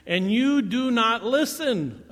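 fed-up emphatic rebuke; exasperated. Acted. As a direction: hammer each word in frustration. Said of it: "he's kinda getting ticked off".

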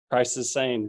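ordinary talk

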